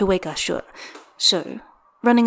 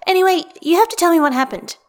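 excited; 9 semitones higher, 4 dB louder than usual. Acted. Roommate demanding gossip.

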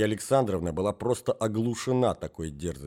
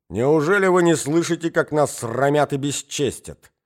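angry tirade; 3 semitones higher, 7 dB louder than usual. A narrator's reading. Wife berating husband.

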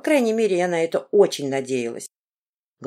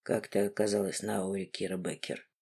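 low, quiet, icy man's question; 10 semitones lower, 12 dB quieter than usual. A narrator's reading. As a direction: freeze her with every word. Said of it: "Ледяным голосом спросил Мартин".

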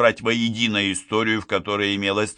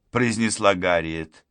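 usual speaking voice

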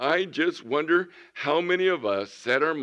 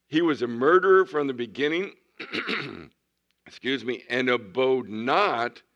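scripture reading; 3 semitones higher, 5 dB louder than usual. Acted. Reading Jesus' words from scripture.

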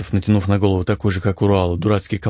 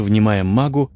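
ordinary delivery, nothing marked

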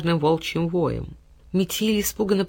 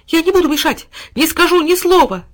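high, loud desperate begging; trembling pleading dog; 10 semitones higher, 7 dB louder than usual. A narrator's reading. Dog begging his boy to stay, high and desperate. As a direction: beg not to be sent away. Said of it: "дрожащим голосом принялся умолять".

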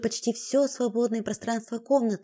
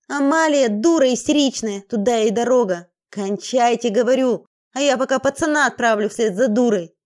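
angry shouting; 6 dB louder than usual. Acted. Thug barks orders in panic.

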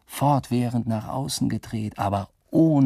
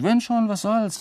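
higher, careless "was soll's?" tossed off; high shrug; 11 semitones higher than usual. Acted. Shrugging off being seen.

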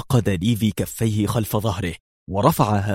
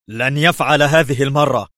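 higher, louder furious cry; shouting; 5 semitones higher, 4 dB louder than usual. A narrator's reading. The ambassador shouts after striking his desk, furious. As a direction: yell as you slam the desk. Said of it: "صارخا".

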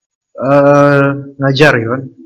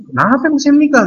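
imitating the old man; 11 semitones higher than usual. Ustaz mimics the lonely old man asking why, lightly effeminate voice. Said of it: "Tapi orang nggak ngomong gitu, cuman".

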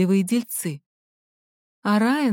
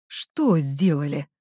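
slightly lowered male voice, asking back; a slow, lower questioning voice; мужской персонаж переспрашивает; 5 semitones lower, slower than usual. A narrator's reading. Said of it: "переспросил он". (переспрашивает низким голосом, медленно)

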